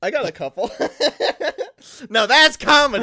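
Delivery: giggling through the words; laughing, high and loud. Real, not acted. After making fart noises.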